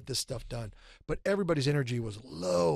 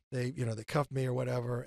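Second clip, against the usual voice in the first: normal talking voice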